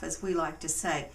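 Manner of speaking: ordinary delivery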